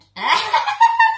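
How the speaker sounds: very high, loud cackle; shrieking laugh. Real, not acted. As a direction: explode into a high-pitched laugh. Cracks up at the comment. (kusog ug hataas nga halakhak)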